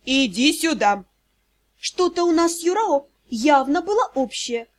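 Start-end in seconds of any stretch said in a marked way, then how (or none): 0.1-1.1 hoarse, curt order; hoarse command. Acted. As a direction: rasp out an order. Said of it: "хрипло приказал". (хрипло приказать)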